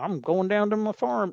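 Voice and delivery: a touch of put-on Southern twang; mock Southern accent. Mocking a president's put-on Southern accent. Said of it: "His dad didn't have some Southern accent".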